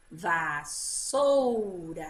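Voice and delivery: "Vassoura" slow and low, pulled apart at the double S; split into syllables. Shown as a demonstration. Teacher showing syllable separation, "Vassoura" slowly split at the S. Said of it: "separando na sílaba".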